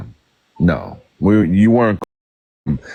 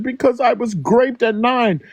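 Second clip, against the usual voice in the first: mocking the woman; 12 semitones higher, slower than usual. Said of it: "She said".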